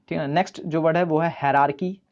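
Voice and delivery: normal talking voice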